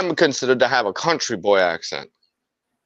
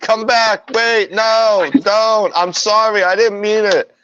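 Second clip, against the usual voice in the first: high-pitched pretend begging; mock pleading; 7 semitones higher, slower than usual. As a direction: call after someone in mock desperation. Joking plea after departing delivery driver.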